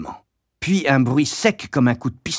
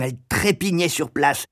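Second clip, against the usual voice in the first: ordinary delivery, nothing marked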